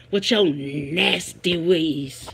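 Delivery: lightly voiced scolding movie woman, drawn out; movie line quote. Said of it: "That was on the Women of Brewster Place".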